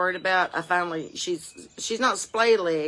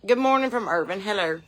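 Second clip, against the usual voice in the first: usual speaking voice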